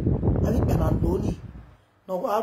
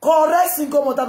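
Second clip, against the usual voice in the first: 1 emphatic call; 5 semitones higher, 5 dB louder than usual. Real, not acted. Loud mocking rant.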